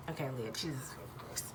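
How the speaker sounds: whispered under her breath, fed up with herself; exasperated whisper. Real, not acted. Reader scolding herself mid-reading in an exasperated whisper. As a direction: hiss it to yourself in frustration.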